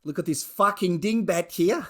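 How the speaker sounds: put-on Australian accent. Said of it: "that sort of Australian accent".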